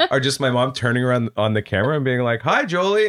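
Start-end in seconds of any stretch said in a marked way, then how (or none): 2.4-3.1 high, singsong mom hello; higher-pitched retold greeting; imitated mom greeting. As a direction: imitate a mom behind the camera. Mom greeting child on camera.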